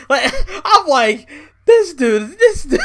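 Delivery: high-pitched incredulous laughter; laughing. Real, not acted. A YouTuber laughs at Vince's ungrateful speech, high laughter. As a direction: crack up in disbelief.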